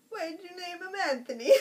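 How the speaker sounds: high, loud mock question; imitated relative. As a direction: put on the relative's loud complaint. Mimicking the thick-accented relative's question.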